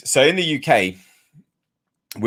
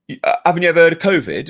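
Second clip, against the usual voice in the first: higher and louder, a hint of the accusing neighbour; mock snide neighbour; 8 semitones higher, 5 dB louder, faster than usual. Retelling the neighbour's jab across the road, mock snide and higher.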